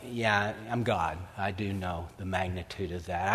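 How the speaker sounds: a light take on a patient God; God's voice. Retold or imitated. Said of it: "And God's like".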